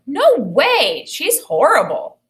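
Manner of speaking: put-on outraged child flatly refusing; indignant refusal. A narrator's reading. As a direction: sister protests loudly, refusing to help. Sister refusing to help neighbor.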